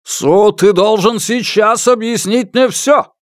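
slow, high, insistent shout; angry demand. A narrator's reading. Father pushes the wife aside and confronts Su, angry demand. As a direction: demand it forcefully, word by word.

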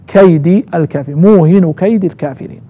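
كيد as kaydi; kasra on كيد. Shown as a demonstration. Teacher showing the kasra, كيد ending in -i. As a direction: join kaydi to الكافرين with an i. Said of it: "بالكسر".

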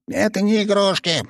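high indignant shout. Acted. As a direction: yell it in protest, pitched high. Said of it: "закричал он".

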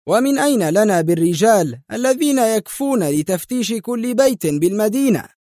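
high-pitched exclaimed objection; exclaiming. A narrator's reading. José objecting to searching every house, exclaiming. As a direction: cry out the question in protest. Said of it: "هتف جوزيه".

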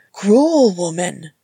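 loud, drawn-out mock outrage; slow, punched accusation; indignant exclamation. A narrator's reading. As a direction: cry out in playful protest. Uncle protests after being kicked.

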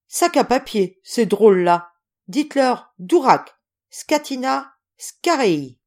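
loud, high, emphatic swearing from an impatient general; exasperated barked orders; blustering cursing. Acted. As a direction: fire off each insult separately, with force. General swears, dictates Russian insults.

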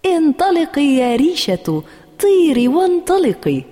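high, gleeful cheering-on; playful, lifted child's cry; laughing playfully. A narrator's reading. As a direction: call it out brightly, as if playing. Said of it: "وهي تقول ضاحكة".

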